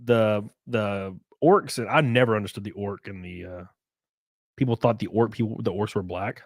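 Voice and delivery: ordinary talk, nothing marked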